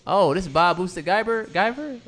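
high, startled question; amazed, rising disbelief; surprised. Real, not acted. Surprised at the screen.

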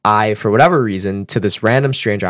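ordinary talk, nothing marked

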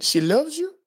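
high teasing question; high teasing neighbor asking about the girlfriend. Retold or imitated. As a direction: tease like an old neighbor.

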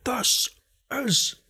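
high-pitched mock battle cry, word by word; slow parody of an epic movie line; Gerard Butler. Spoofing the film Three Hundred for co-host, hammed-up impression. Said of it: "think of him in three hundred".

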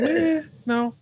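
high, bored "meh"; bored teen girl voice. Retold or imitated. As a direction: brush it off like a teen. Daughter quoted.

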